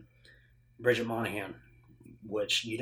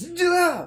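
loud, high imitated yelp; jolted-awake cry; 9 dB louder than usual. Imitating Ethan Hunt getting electrocuted awake, jolted cry. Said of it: "he gets electrocuted awake".

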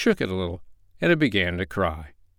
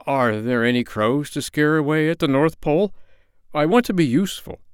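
higher, quicker at the end, a bit anxious; eager, worried to be of use; anxiously; 6 semitones higher, 3 dB louder than usual. Acted. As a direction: scarecrow anxiously asking Santa for work. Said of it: "Jimmy Scarecrow said anxiously".

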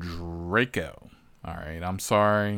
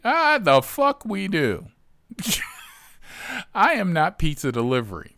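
louder and higher, dragged-out scoff; 10 semitones higher, 4 dB louder than usual. Real, not acted. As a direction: scoff in outraged refusal. Refusing the passenger.

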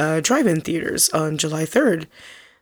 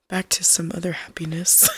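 whisper; 8 dB quieter than usual. Real, not acted. Aside after sad topic.

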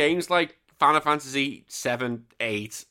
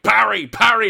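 high, loud mock game command; sudden loud, high-pitched bark; urgent shout; 10 semitones higher, 12 dB louder than usual. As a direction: bellow it like an insistent coach. Acts out game demanding parries.

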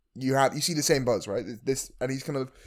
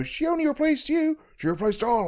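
put-on high baby voice; 14 semitones higher than usual. Big Baby voiced, retold.

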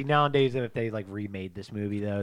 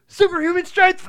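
loud, high cartoon battle cry; cartoon kid shout; 12 dB louder than usual. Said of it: "he's like".

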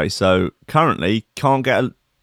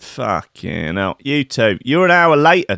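usual speaking voice

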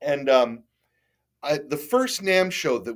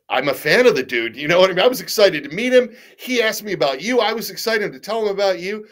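excited explanation; 7 semitones higher, 5 dB louder than usual. Retold or imitated.

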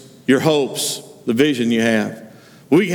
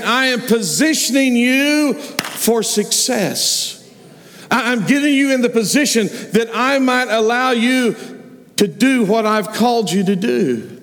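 God reassuring Joseph, slightly higher; God speaking; 6 semitones higher than usual. Acted.

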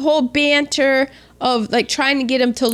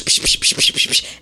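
slap noises. Retold or imitated. Imitating the slap sounds.

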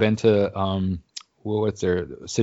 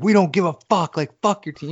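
high, heated mock outburst; retold angry retort; 10 semitones higher than usual. Rival fans rejecting the excuse, high mock-angry retort. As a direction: snap back like a riled rival fan.

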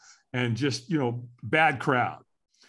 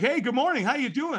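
much higher, bright sing-song hello; cheery greeting; 11 semitones higher than usual. Retold or imitated. AA member phoning early, cheery much higher greeting. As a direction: chirp it like an annoyingly cheerful caller. Sponsor calling cheerily at eight.

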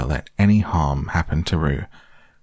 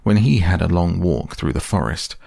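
plain reading voice

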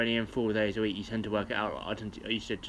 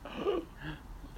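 sharp high gasp; 8 dB quieter than usual. Real, not acted. Mock shock at bus app.

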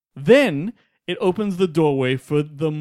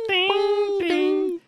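bell imitation; 13 semitones higher than usual. Imitating a bell after song clue.

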